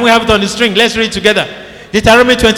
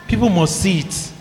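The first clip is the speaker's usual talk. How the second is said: normal talking voice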